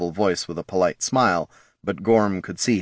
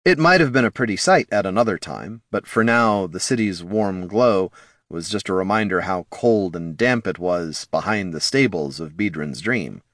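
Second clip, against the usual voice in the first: ordinary delivery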